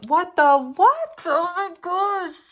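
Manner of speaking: high, loud, drawn-out gasp of disbelief; stunned at the card, let it burst out slowly; real astonishment, voice leaping up; big shocked reaction. Real, not acted. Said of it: "why I have such big reactions".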